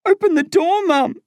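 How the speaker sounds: very high, strained plea; begging. A narrator's reading. Crying boy begging mum to open up, desperate. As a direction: beg her desperately. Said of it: "I beg".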